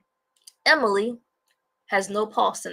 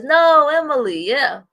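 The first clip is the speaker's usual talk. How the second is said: mock cry; 7 semitones higher, 8 dB louder than usual. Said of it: "She says".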